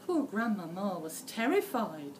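ordinary delivery

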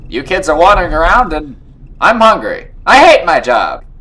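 loud, lightly put-on cop voice; exasperated officer on duty; grumbling police officer. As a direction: complain loudly as a fed-up officer.

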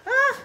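loud high yelp; sharp high cry. Real, not acted.

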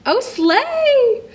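high, loud gleeful exclamation; excited squeal. Real, not acted. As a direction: squeal it out with delight. Excited outburst while reacting to story.

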